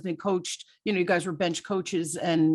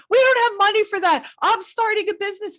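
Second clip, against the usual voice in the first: much higher and louder, frantic; frantic objection; 11 semitones higher, 6 dB louder than usual. Retold or imitated. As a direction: shoot it down like a frazzled wife. Frazzled wife arguing back.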